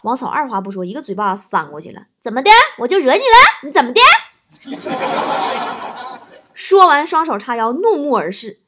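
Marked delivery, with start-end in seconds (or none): 2.2-4.0 loud, high, punchy retorts; hammed-up squaring up for a fight; defiant challenge. Wife daring her husband after slapping him, defiant.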